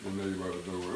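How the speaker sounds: ordinary talk, nothing marked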